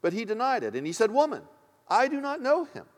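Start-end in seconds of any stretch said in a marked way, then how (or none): 1.1-2.9 raised-pitch, insistent disavowal; emphatic denial. Acted. Peter denying Jesus to a servant woman, emphatic denial. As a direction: deny it firmly, pitched up high.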